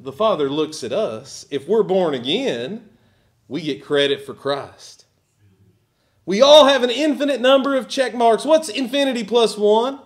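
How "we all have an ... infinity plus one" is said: high, loud and quick sermon burst; fervent preaching. Acted. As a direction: drive the point home fast. Preaching at full force.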